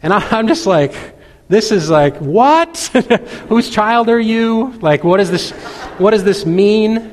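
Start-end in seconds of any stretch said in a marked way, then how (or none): 2.2-7.1 high-pitched, laughing disbelief; amused, incredulous retelling; mock astonishment. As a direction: act out a dumbfounded parent, pitched up. Acted astonished father retelling own reaction.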